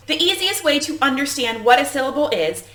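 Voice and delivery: normal talking voice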